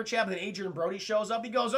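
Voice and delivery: ordinary talk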